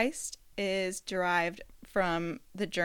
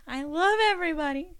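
gushing; 8 semitones higher, 5 dB louder than usual. Real, not acted. Gushing thanks to listeners.

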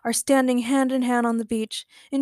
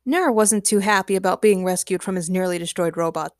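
usual speaking voice

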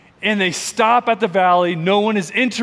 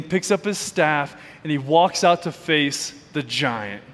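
ordinary talk

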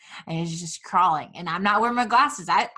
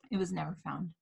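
ordinary talk, nothing marked

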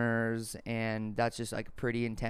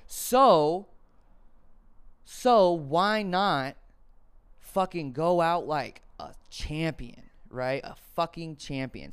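slow defiant resolve; 4 semitones higher, 3 dB louder, much slower than usual. Retold or imitated. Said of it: "And I was like".

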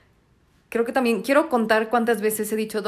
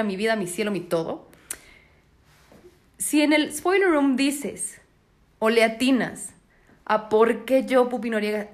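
usual speaking voice

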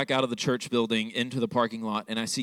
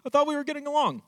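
alarmed schoolboy worry, pitched way up; mock panic; 14 semitones higher, faster than usual. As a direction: panicky inner voice, much higher. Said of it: "principal's office alarm off".

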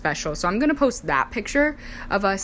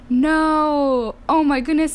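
high, loud disbelief, re-enacted; retold astonishment; 9 semitones higher, 4 dB louder, slower than usual. Bride sees the surprise proposal, re-enacted in loud high astonishment. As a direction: stretch out a stunned, high-pitched reaction.

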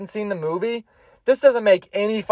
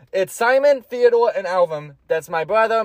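normal talking voice

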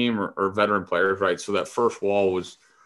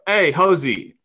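high, loud hail to a teammate; raised, pitched-up call; 8 semitones higher, 5 dB louder, slower than usual. Retold or imitated. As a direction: shout a name across the room. Retold calling to a teammate.

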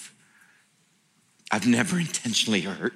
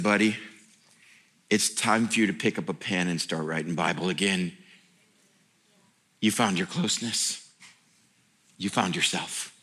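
low, softer, tender; tender voice of God; 5 semitones lower, 3 dB quieter than usual. Retold or imitated. God welcoming the pastor home, low and tender. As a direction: gently welcome him home.

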